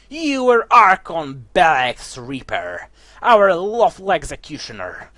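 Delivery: loud, slow villain accusing; necromancer voice. Acted. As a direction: boom it out like a dark sorcerer. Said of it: "necromancer voice".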